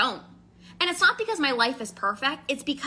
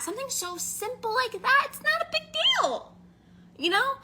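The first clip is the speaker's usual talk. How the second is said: sing-song high; 12 semitones higher than usual. Acted. Mocking triviality.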